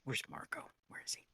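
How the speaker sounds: unvoiced hushed question; whispering. Real, not acted. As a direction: whisper it under your breath.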